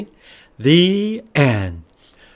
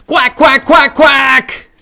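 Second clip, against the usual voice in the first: rapid volley of loud, high quacks; mad duck; 11 semitones higher, 8 dB louder, slower than usual. Acted. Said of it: "That duck is sure mad".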